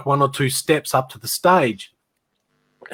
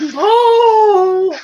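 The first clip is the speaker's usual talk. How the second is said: long, loud falsetto holler; drawn-out high-pitched shout; heckling yell; 8 dB louder than usual. Retold or imitated. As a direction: mimic hecklers wailing a name. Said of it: "you could hear them going".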